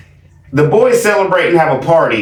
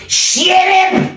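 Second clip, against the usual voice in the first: sharp high shout of shut up. Retold or imitated. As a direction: bark the order much higher. Mock order to keep kids quiet.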